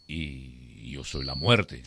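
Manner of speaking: low, slow, soft Death voice; Death's voice. Acted. Death answers the frightened farmer in a low, slow voice. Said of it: "le dice".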